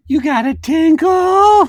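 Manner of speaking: very high, loud, drawn-out singsong; piercing, cooing parent call; tinkle voice. Retold or imitated. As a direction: call out high and slow through a bathroom door. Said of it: "that's my tinkle voice".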